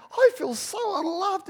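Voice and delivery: high, loud whiny complaint; mock complaint. Husband whining to wife, high and loud. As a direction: whine it like a hurt husband. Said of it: "And I'd be going".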